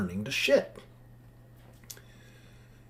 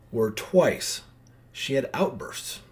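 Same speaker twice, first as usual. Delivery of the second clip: ordinary delivery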